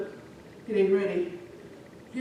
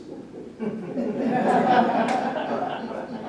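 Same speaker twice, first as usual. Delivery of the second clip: loud burst of laughter; real laugh; 4 semitones higher, 5 dB louder than usual. Real, not acted. Storyteller after the two-languages punchline, real laugh.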